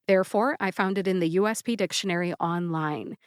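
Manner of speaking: normal talking voice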